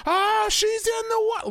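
high shouted play-by-play; excited commentator shout. Pretend. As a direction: yell as she hits water. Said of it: "where's the energy of".

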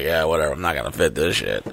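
low, soft, unbothered shrug; resigned dismissal. Retold or imitated. Fat rider to ride operators, resigned and dismissive. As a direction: give up quietly under your breath. Voicing his own resigned reply.

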